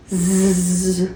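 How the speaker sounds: a sleepy puppy dozing off; mock snore. Puppy falls asleep.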